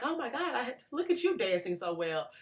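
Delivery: imitated high-pitched gushing astonishment; blown-away amazement. Speaker mimicking her reaction to a girl dancing, amazed. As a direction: exclaim it high, as if wowed. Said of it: "blown away by it".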